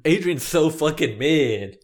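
slow teasing put-down; mocking drawl. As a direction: draw out the insult mockingly. Teasing co-host slowly.

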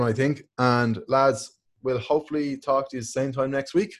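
ordinary talk, nothing marked

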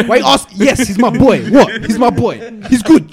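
loud, defensive insistence. Retold or imitated. Defending his drunk friend to nosy strangers, loud. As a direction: raise your voice to insist he's fine.

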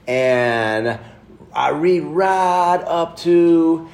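slow, drawn-out Southern drawl; Southern accent. Retold or imitated. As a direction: stretch it out like the Southern man. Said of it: "in his great Southern accent".

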